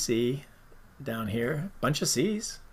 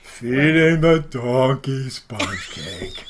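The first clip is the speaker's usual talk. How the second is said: very slow, mournful donkey drone; Eeyore voice; 4 dB louder, much slower than usual. Pretend. Host doing his best Eeyore for his co-host. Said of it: "That was his best Eeyore".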